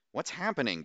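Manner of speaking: a hint of a sharp demand. A narrator's reading. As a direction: insist on an answer. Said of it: "Bose demanded".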